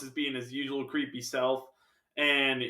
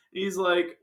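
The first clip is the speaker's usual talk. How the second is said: ordinary talk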